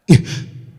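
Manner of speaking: short breathy anime "huh" vocalization with inhale; a quick all-purpose anime exhale-grunt; standard one oh two grunt. Shown as a demonstration. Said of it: "sounds like this".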